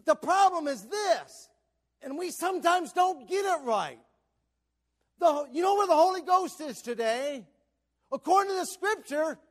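loud, high pulpit shout; full-force preaching. Real, not acted. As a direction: hammer the point home to the congregation.

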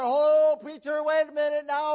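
loud, high mock protest; acted-out alarmed objection; imitated objection. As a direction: break in like a worried congregant. Voices an objecting church member.

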